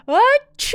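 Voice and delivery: loud, high, explosive bear sneeze; big sneeze. Acted. Bear sneezes from pepper, loud explosive achoo. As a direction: blast out a huge sneeze. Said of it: "He blows and he sneezes".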